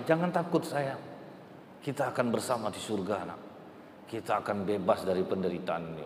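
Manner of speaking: put-on mother calming her child before death, soft and tender; tender comfort. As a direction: hush him gently toward heaven.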